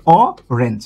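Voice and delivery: অরেঞ্জ said slowly, starting on the open vowel অ; slow demo of orange. Shown as a demonstration. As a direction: draw out অরেঞ্জ from its first vowel. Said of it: "এইভাবে".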